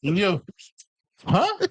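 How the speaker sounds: high mocking kid voice. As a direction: squeak it out like a teenager. Mocking kids' slang talk.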